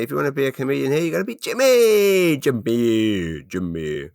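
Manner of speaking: a hammed-up mock of a fellow comic, pushing the name; imitating Marshall Button. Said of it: "Marshall Button said to me".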